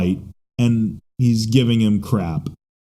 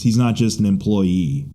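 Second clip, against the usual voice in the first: normal talking voice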